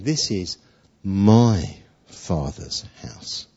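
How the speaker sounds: "my" slow and weighty in "This is my Father's house"; stress on MY. Shown as a demonstration. Preacher stresses whose house it is: weighty "my", slow. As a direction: lean hard on "my", slow down. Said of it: "He's saying".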